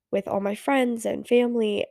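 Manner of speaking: normal talking voice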